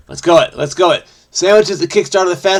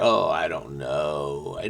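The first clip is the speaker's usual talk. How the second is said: low, slow, soft groaned admission; long, low drawn-out uncertainty; stumped; 11 semitones lower, 10 dB quieter, slower than usual. Real, not acted. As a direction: sink low and drag it out, stumped. Stumped by a crossword clue.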